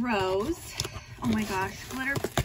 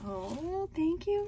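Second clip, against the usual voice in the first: ordinary talk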